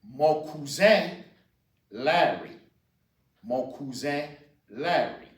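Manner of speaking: "mon cousin Larry" said slowly, nasal ending on "cousin"; careful, slow model phrase; slow teaching demo. Shown as a demonstration. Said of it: "Then you have".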